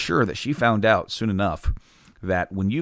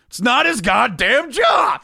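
slow, furious shout, much higher and louder; angry shout; 5 dB louder, slower than usual. Retold or imitated. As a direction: bellow it like a fed-up worker who has had enough. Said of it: "looked at me and goes".

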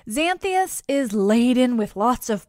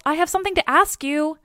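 raised-pitch call; 7 semitones higher than usual. A narrator's reading. Dionysus stopping Heracles from leaving, calling out. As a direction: call to hold someone back. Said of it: "he calls".

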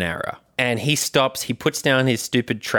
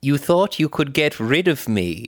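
higher-pitched put-on mech monarch; the Queen; 4 semitones higher than usual. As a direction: play a defiant British royal robot. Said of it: "and she's a mech now".

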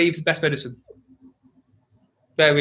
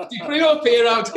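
laughing; 5 semitones higher than usual. Retold or imitated. Laughing while demoing fear exercise.

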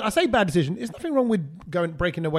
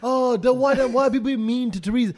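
high-pitched mock protest; imitating complaining people; 6 semitones higher than usual. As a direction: mimic people fussing, pitched up. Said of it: "So people are like".

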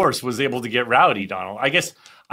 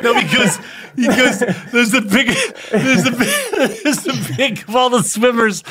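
high-pitched, helpless giggling; real laughing fit while talking; laughing through words; 8 semitones higher than usual. Real, not acted. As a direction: keep restarting as laughter breaks in. Laughing too hard to retell joke.

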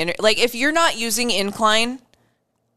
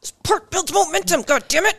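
high-pitched, put-on straining voice; hammed-up exasperation mid-stride; mock exasperated runner; 9 semitones higher than usual. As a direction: blurt it high as if running hard. Acting out running while exasperated.

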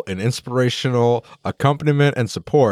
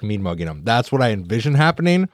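ordinary talk, nothing marked

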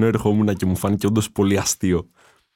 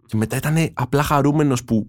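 ordinary talk, nothing marked

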